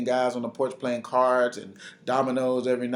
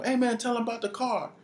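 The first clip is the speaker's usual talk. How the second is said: high-pitched call, no real volume; retold yell; 9 semitones higher than usual. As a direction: imitate someone calling from the back. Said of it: "somebody from behind him yells at him".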